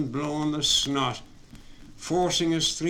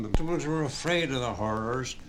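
plain reading voice

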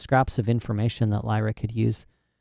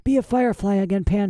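put-on higher-pitched, nervous girl; shakily; 9 semitones higher than usual. A narrator's reading. Frightened Lyra instructs her companion Pan, shakily. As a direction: speak up high with a touch of fright. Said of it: "she said shakily".

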